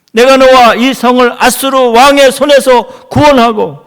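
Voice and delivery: loud, high pulpit exhortation; forceful preaching. Real, not acted. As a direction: drive the promise home to the congregation.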